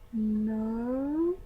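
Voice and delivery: long drawn-out, puzzled no; confused surprise. Retold or imitated. Student guessed to be from New Zealand, drawn-out confused denial. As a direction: stretch it out in mock confusion. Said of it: "really super confused and surprised".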